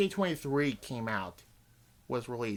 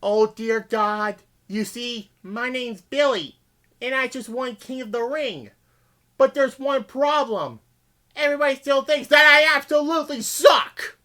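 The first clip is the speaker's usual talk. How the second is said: Billy Gunn voice; 8 semitones higher, 4 dB louder than usual. Retold or imitated. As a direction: play a pitiful wrestler begging God. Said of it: "and Billy, he's voicing".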